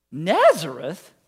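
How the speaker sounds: slow, loud disbelieving "Nazareth?"; drawn-out doubtful Nazareth; incredulous exclaim. Acted. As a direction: scoff at the town's name. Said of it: "exclaimed Nathanael".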